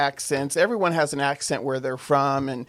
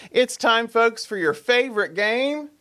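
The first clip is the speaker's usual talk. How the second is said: game show announcer; 8 semitones higher than usual. Pretend. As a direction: announce the game to the folks, pitched up. Host announces the game segment.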